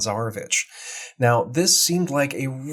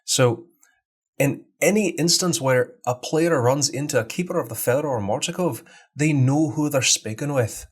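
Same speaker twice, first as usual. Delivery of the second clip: Scottish brogue; Scottish accent. Shown as a demonstration. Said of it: "a Scottish accent".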